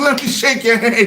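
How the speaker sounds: high-pitched, through laughter; giggly high mock admiration; laughing imitation. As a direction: quote the delighted stranger while cracking up. Stranger quoted through laughter.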